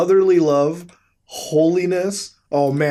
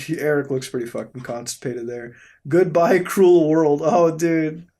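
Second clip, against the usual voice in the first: ordinary talk, nothing marked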